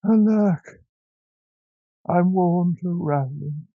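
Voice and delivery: high, loud sighing cry; weary old tailor. A narrator's reading. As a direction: lament like a tired old man. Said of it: "said the tailor of Gloucester".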